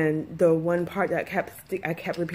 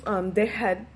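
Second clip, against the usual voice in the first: ordinary delivery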